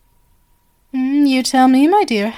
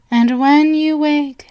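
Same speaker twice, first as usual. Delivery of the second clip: ordinary talk, nothing marked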